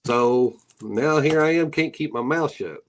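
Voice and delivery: ordinary talk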